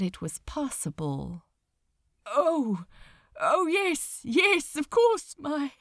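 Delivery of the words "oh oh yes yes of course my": high, strained voice choking on each word; a choked reply that climbs higher and breaks; words catching in throat. A narrator's reading. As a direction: force the words out as they catch. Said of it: "his words almost caught in his throat".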